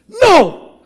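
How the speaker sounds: high, loud shouted "No!". Retold or imitated. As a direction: shout it out like an outraged seatmate. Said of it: "I hear her very loudly go".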